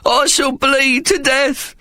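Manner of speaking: very high, slow, drawn-out lament; milk the terror of dying; a sniveling coward wailing at his fate; melodramatic wail. A narrator's reading. Cowardly Ikey panicking.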